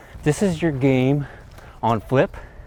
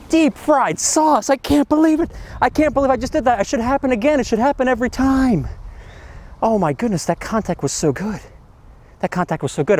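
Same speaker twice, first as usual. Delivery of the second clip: drooling golfer; 8 semitones higher than usual. Retold or imitated. Coach imitating drooling students after a great shot, swooning and much higher. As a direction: ham up a golfer in ecstasy over a crisp strike, much higher. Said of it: "literally drooling after hitting".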